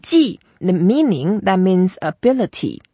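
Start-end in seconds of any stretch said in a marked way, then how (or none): 0.0-0.4 "Ji" pitched high, then plunging; falling, slightly excited. Shown as a demonstration. Teacher confirms the learner's tone, "Ji" high and falling. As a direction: start "Ji" high and drop it. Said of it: "make it down as if we were a little bit excited".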